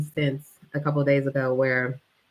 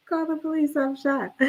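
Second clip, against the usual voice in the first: panicked plea; 11 semitones higher, 4 dB quieter than usual. Retold or imitated. Shot girl begging.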